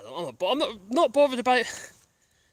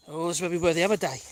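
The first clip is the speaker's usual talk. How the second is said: usual speaking voice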